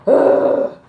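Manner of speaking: loud, breathy, high exasperated sigh; big sigh. Retold or imitated. Caller sighing at every question, loud exaggerated sigh. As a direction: blow out a huge annoyed sigh. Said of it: "this big sigh".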